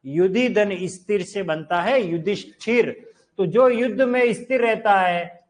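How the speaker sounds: normal talking voice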